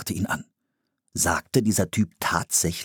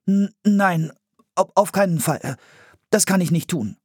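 stammering, high-pitched protest; flustered boy fending off the idea; alarmed refusal; 11 semitones higher, 4 dB louder than usual. A narrator's reading. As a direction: stumble over the words, backing out. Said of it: "wehrte Philipp ab".

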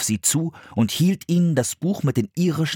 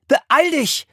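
put-on high boy's voice urging; high, pressing call; urgent demand; 4 dB louder than usual. A narrator's reading. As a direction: hurry your sister along. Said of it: "forderte Philipp seine Schwester auf".

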